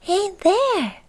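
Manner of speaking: tiny, high-pitched hello; very high little creature voice; little goblin voice. Acted. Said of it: "a little voice".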